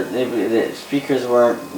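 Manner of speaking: ordinary talk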